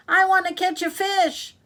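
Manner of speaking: put-on high, loud childlike demand; impatient child. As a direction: whine it out, impatient for a catch. Impatient girl demands a fish.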